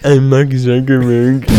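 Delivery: slow tech-boss impression; Mark Zuckerberg. Shown as a demonstration. Doing an impression for laughing friends, slow tech-boss voice. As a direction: introduce yourself slowly as a tech founder. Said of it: "It sounds like him".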